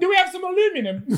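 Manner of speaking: high-pitched British clerk; mock British accent. Asking Shantae for the UK listeners, high British voice. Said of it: "I wanna help our people in, uh, in the U K".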